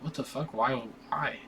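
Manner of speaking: soft, bewildered under the breath; half-voiced disbelief; confused mutter. Slapped kid staring at Barney, a quiet confused mutter.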